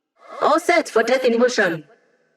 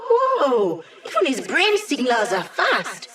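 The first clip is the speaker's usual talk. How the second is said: amused awe; 4 semitones higher, 3 dB quieter, slower than usual. Acted. Impressed at fast brain signals.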